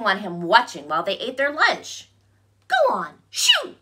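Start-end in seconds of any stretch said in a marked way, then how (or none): 2.7-3.8 high squawk, leaping up on shoo; squawking bird. A narrator's reading. Bird chasing bear from her nest, squawking. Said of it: "squawked the bird".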